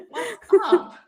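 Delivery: high, loud jokey greeting; laughing mock hello; imitating a casual brother. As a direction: call it out high and playful. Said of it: "It's like".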